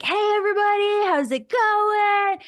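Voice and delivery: high, loud, bubbly hello to the room; mock loud actor greeting, pitched high; loud, space-taking greeting. Teacher imitates a loud actor greeting the room, high and loud. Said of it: "loud and taking up space".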